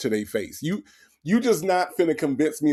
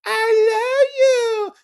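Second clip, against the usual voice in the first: very high, slow crying falsetto; high mocking sob voice; mock whiny love; 5 dB louder, much slower than usual. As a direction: wail it in a squeaky fake sob. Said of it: "and she gonna say".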